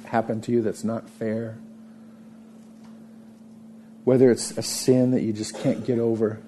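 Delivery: ordinary talk, nothing marked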